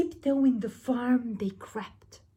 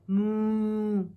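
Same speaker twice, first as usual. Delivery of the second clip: steady cow moo. Acted. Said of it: "And the cow said".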